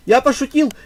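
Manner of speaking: loud, high panicked cry; panicked shout. Real, not acted. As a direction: yell it out in panic. Panicked retraction to opponents.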